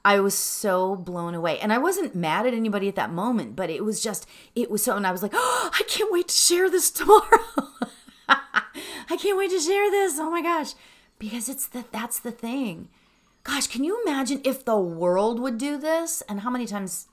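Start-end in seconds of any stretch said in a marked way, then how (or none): 5.3-10.7 high, loud, laughing squeal; giddy excitement. Retold or imitated. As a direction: burst out, thrilled and laughing. Retells own excited reaction, laughing.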